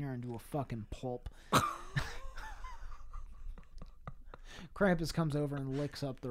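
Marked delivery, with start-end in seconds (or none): 1.5-4.7 real high, wheezy laughter; breathless laughing fit. Real, not acted. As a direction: laugh until only air comes out. Cracking up at the gory story.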